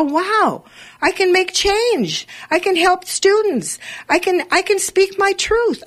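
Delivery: high, wondering, slow joy at first; a thrilled discovery, pitched well up; excited realization. Retold or imitated. Radio host recalls her student awakening, high and thrilled.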